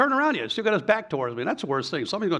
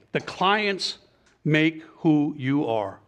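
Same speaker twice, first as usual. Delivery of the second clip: slow, drawn-out key point; deliberate emphasis; much slower than usual. Real, not acted. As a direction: stretch out the words for weight. Drives home the main point.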